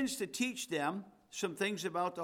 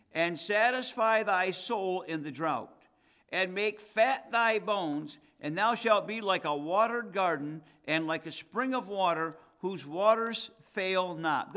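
loud, ringing Bible reading; emphatic scripture reading; 2 semitones higher, 6 dB louder than usual. Acted. Preacher reading Isaiah fifty-eight to the congregation, loud and emphatic. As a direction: proclaim the verse at full voice, stretching key words.

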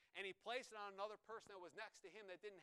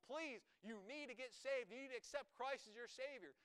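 pleading; 6 semitones higher than usual. Retold or imitated. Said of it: "he was pleading with people".

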